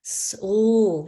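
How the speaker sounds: "sl" punched out loud; emphatic sl sound; loud isolated blend. Shown as a demonstration. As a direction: hit the sl blend hard. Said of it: "What's the next sound?".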